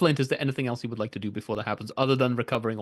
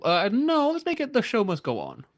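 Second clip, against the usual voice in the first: excited; 9 semitones higher than usual. Acted. GM grandly renames the bonus.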